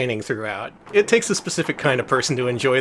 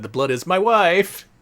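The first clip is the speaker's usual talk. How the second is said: mock quote, higher and drawn out; slow, louder comic mangling of a line; joking misquote; 8 semitones higher, 5 dB louder, slower than usual. Streamer jokingly misquotes the patient, mock quote. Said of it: "he said".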